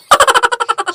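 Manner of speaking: put-on a short, high old-woman cackle; high cackling laugh. Old mother laughs at "papad sellers", high cackle. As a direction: cackle at your own joke.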